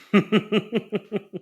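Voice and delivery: a long high burst of laughter. Real, not acted. Co-host cracking up at being teased about sharks, long laugh.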